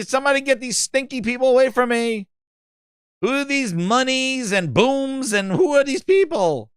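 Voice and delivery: high, put-upon mock complaint; mock awkward host. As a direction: imitate her recoiling from the crowd in a high voice. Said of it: "she's gonna be like".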